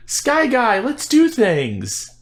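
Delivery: put-on high, slow bratty kid voice; Ahsoka impression. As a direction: squeal it like an annoying kid. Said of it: "the lady who ran around screaming".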